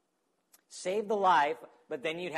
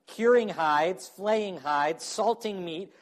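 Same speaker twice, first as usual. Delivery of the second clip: ordinary talk, nothing marked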